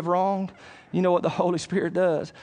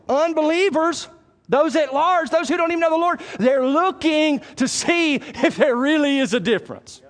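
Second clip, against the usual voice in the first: high, raised preacher's voice; pitched-up pulpit proclamation; forceful preaching; 11 semitones higher, 4 dB louder than usual. Real, not acted. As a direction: preach it urgently to the whole room.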